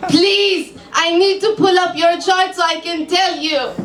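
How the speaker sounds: put-on lightly Hebrew-accented psychic, businesslike. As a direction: ask calmly, Hebrew accent. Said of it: "I've never done a Hebrew accent".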